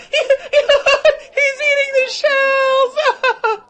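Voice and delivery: high, slow, laughing mockery; crowd laughing. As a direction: laugh it out at the boy. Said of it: "laughed the crowd".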